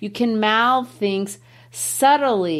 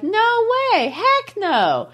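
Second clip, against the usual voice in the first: high, drawn-out protest; emphatic refusal; 13 semitones higher, slower than usual. Acted. Teacher paraphrases the poet's strong "nay", emphatic high-pitched refusal. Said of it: "He feels strongly".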